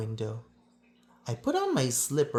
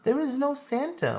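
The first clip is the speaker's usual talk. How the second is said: a lightly voiced kid insisting, pitched up; a touch of a stubborn child; friend insisting; 10 semitones higher, faster than usual. A narrator's reading. Friend insists to the narrator, mildly voiced high. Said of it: "my friend had insisted".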